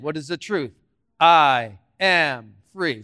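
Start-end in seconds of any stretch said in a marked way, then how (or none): 1.2-3.0 slow declaration. Shown as a demonstration. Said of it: "Say that out loud".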